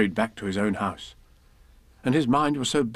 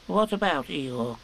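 solemn Piglet; 4 semitones higher, slower than usual. Acted. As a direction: speak gravely as a small piglet. Piglet asks.

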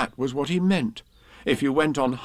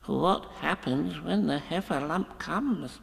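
soft, slow, trembling question; tremblingly; 5 semitones higher, 5 dB quieter, slower than usual. Acted. Piglet asking Pooh, softer and trembling. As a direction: ask it slowly, scared and soft. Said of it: "asked Piglet tremblingly".

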